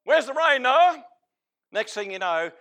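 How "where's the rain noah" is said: loud, high taunting shout; jeering mob. Retold or imitated. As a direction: heckle Noah like a mocking mob. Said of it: "What do you think that mob's doing".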